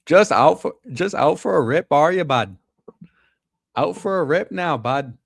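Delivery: loud, high, hammed-up Canadian; mock Canadian accent. Streamer trying out Canadian slang on a bud, exaggerated Canadian accent. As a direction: lay on a thick, singsong Canadian accent for a buddy. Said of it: "can't even do a great Canadian accent".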